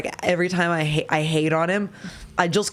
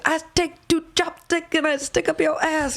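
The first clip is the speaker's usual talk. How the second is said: mock Chinese accent; 11 semitones higher than usual. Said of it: "one of my favorite YouTube videos".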